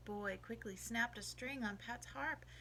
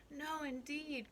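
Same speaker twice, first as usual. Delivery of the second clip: higher, drawn-out protest; alarmed cry; 6 semitones higher, slower than usual. A narrator's reading. Old Pat denying he wants gold, crying in alarm. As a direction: cry it slowly in mild alarm. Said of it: "cried old Pat, mighty alarmed".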